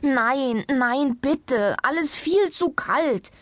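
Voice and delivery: high, drawn-out protest; pleading cry. A narrator's reading. Patient begging his doctor off winter sports, crying out. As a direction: call out in distress, begging to be spared. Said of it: "rief Herr Ombovesi".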